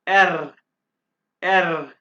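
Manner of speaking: एर said with a rolled, trilled r; German R with a trilled tongue. Shown as a demonstration. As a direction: vibrate the tongue on एर. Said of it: "आपने जुबान को vibrate करना है".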